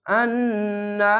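আন্না with a very long hummed N; obligatory ghunna. Shown as a demonstration. Teacher building the word for learners, drawn-out nasal N. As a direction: hold the double N of আন্না in the nose. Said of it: "ওয়াজিব গুন্না".

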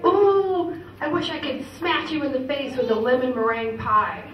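a little kid's mock rage at her dad; mock kid fury. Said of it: "I would say".

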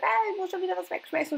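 high-pitched mock complaint; imitated grumbling. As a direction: imitate yourself griping at your husband. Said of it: "dass ich immer anpflaume, wenn ich sage".

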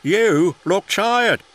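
a cheerful boss noticing a tired engine; kindly boss voice. A narrator's reading. Fat Controller greets Percy.

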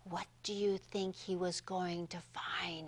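hushed secret whisper; storyteller whispering to children; suspense whisper. Acted. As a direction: lean in and whisper it. Suspense question to kids.

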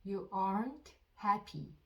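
very slow, each word spaced out for repeating; dictation-slow teacher read; slow model sentence. Shown as a demonstration. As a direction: say it slowly so learners can follow. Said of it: "듣고 따라해 보세요".

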